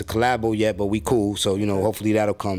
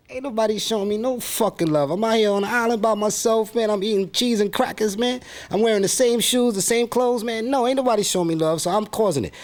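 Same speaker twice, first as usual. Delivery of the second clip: much higher, aggrieved put-on rapper voice; mad rapper persona; 12 semitones higher than usual. Rapper alter ego complaining to interviewer, high put-on voice. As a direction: gripe like a bitter, neglected rapper stranded alone. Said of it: "from the perspective of the mad rapper".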